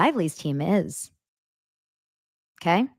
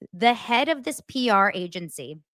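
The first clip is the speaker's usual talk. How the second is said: ordinary delivery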